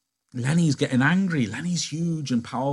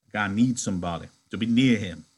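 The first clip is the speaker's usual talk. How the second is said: usual speaking voice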